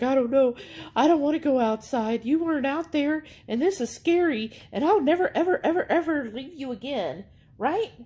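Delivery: high, pleading puppy voice; dog's voice. Pretend. Voicing the dog Delilah.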